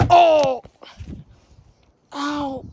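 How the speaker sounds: loud, high cry of hurt; hammed-up painful wail; pretend pain. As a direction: yelp as if smacked. Reacts to being smacked.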